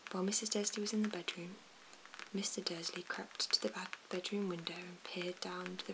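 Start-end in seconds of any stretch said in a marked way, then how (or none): none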